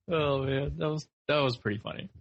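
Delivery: ordinary delivery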